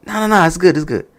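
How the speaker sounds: high-pitched quick reassurance; acted brush-off. Acts out Randy brushing off waiter.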